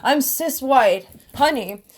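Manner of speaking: high, loud mocking voice building to a shout; mock screaming cis woman. Mocking Christians who scream at him, high and loud. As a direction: ham up a sassy girl yelling at someone. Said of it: "'cause I can scream".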